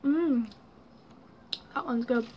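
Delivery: chewing while talking; coughing from fizzy candy; mouth full of candy. Real, not acted. Seen 1: Candy put in mouth at -7; lips working, cough-covering hand around 2s.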